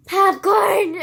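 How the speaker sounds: loud, high shout with a laugh in it; calling out. Real, not acted. Vlogger interrupts herself to call to her pet, laughing shout. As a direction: yell the pet's name, half laughing. Calls out to the pet mid-sentence.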